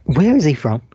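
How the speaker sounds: loud, high burst of disbelief; incredulous. Real, not acted. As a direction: demand it loudly, utterly baffled. Baffled reaction to the accent clip.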